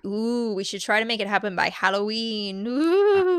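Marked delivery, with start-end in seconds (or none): 2.7-3.4 high spooky ghost ooh; a half-horse, half-spooky wail; playful ghost woo. Acted. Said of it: "general spooky sound".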